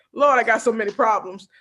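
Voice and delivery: normal talking voice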